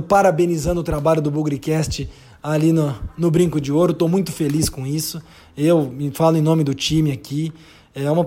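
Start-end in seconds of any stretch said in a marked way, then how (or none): none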